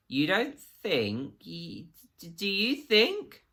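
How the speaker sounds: slow, rising panicked stutter; horrified realisation breaking through. A narrator's reading. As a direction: stumble and climb into a panicked question. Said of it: "Absalom stuttered".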